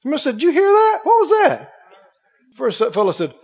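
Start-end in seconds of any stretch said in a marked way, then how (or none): 0.3-1.7 high-pitched astonished questions; startled bystander. Acted. Bystander hears a voice from heaven, startled. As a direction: act out someone startled by a strange sound.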